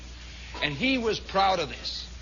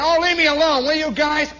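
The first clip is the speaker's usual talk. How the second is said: high, loud put-upon whine; acted complaint, pitched well up; pleading protest; 7 semitones higher, 5 dB louder than usual. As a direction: beg the bullies to lay off. Picked-on Shermy protesting, acted.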